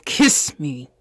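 loud insistent demand; forceful command. Acted. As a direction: repeat it firmly, no longer asking. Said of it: "I said".

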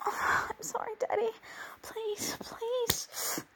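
tearful whispered begging. Acted. Begging her father for forgiveness in a teary whisper. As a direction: plead in a breaking whisper. Begging after being scolded.

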